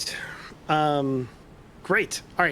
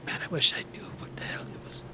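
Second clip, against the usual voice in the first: envious grumble under the breath; half-whispered, jealous aside; jealous muttering; 8 dB quieter, faster than usual. Acted. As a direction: mutter it enviously, almost voiceless. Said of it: "looks at Jed Rawl a little bit jealously".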